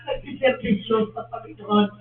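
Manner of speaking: normal talking voice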